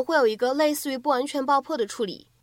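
ordinary talk

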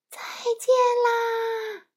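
high, loud farewell shout; shouted goodbye. A narrator's reading. The crowd shouts farewell to the departing wizard, loud. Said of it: "大家都在喊".

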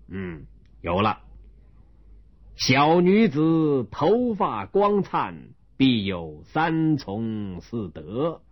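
put-on an old magistrate laying down a test; stern county magistrate. Magistrate sets third couplet.

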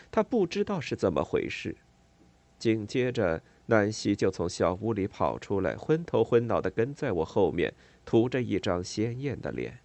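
ordinary delivery, nothing marked

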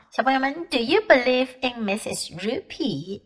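plain reading voice

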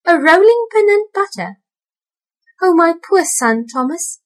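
high, loud horrified cry; alarmed exclamation. A narrator's reading. Tabitha fearing for Thomas, high and alarmed. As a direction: gasp in dread for her son. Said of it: "exclaimed Tabitha".

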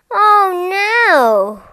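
high, loud "oh no" of dismay; loud storybook dismay; dismayed cry. A narrator's reading. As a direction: cry out in alarm as a story character. Sudden sight of rabbits everywhere.

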